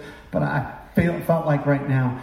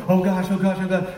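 mock worry; 7 semitones higher than usual. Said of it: "for you to look for".

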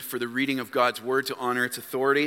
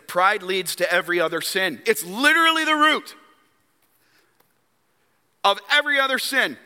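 raised, emphatic sermon delivery; impassioned preaching; 8 semitones higher, 4 dB louder than usual. Real, not acted. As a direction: preach it high and hard, leaning on key phrases.

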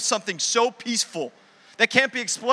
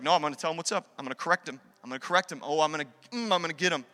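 smug; 6 semitones lower, 5 dB quieter, faster than usual. Retold or imitated. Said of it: "we as a church are like".

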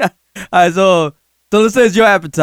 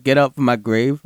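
ordinary delivery

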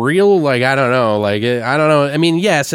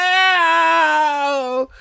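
long, high drawn-out "no" wail; hammed-up cry of despair; mock dramatic despair. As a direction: wail it like a melodrama victim. Acting woman's dramatic reaction to locking.

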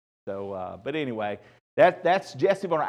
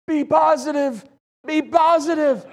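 hammed-up high dying shout of "positive"; insistent shout; 11 semitones higher, 4 dB louder than usual. Dying dad insisting to his family, mock high shout. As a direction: yell it like a dying plea, mock urgent. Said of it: "he kept insisting".

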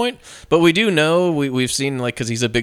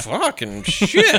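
slow, higher mock awe; imitated amazed viewer; 5 semitones higher, much slower than usual. Viewer watching the sex scene, mock amazed. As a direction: stretch it out, stunned and amused. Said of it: "you're going like".

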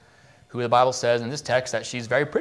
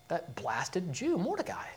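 mock contempt; 3 semitones higher, 11 dB quieter, slower than usual. Haman's contempt in retold story.

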